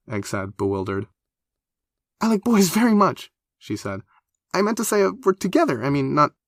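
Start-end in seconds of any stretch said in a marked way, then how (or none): 2.2-3.3 high, louder hasty declaration; flustered blurt. A narrator's reading. 4.5-6.3 put-on high, quick, chatty young woman; eager high girl voice. A narrator's reading. Flustered correction.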